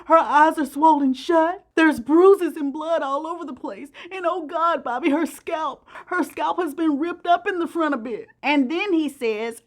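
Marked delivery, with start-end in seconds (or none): 0.0-8.3 imitating crying. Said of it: "breaks down crying in a flood of tears".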